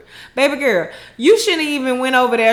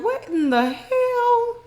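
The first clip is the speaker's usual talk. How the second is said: much higher, slow, stunned cry; shocked disbelief; 10 semitones higher, slower than usual. Retold or imitated. Fatima and Karen hearing about the son, stunned high cry. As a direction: let it rise in disbelief. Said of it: "eyes both lit up".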